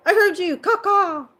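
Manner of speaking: reply like a crow from far away; imitated crow answering its friend. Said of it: "and he'll go".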